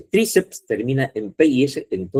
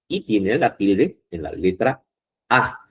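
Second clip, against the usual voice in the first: ordinary talk, nothing marked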